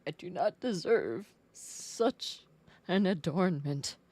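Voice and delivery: pompous thanks for the gift; hammed-up formal voice; mock grand. Mock-formal gratitude for pants.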